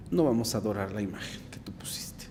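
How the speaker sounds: near-whispered refusal, very low; hushed defiance. Retold or imitated. Young men before the monarch refusing to worship, hushed.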